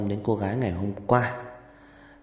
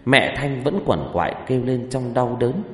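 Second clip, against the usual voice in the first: story narration; 4 dB louder than usual. A narrator's reading.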